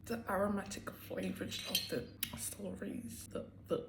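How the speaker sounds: soft whisper savoring the flavors. Real, not acted. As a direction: breathe it like a secret tasting. Whispering about strawberry flavor.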